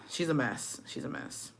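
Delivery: sighed out, very soft and unvoiced; exasperated whisper. Real, not acted. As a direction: whisper it in weary disbelief. Exasperated verdict after a sigh.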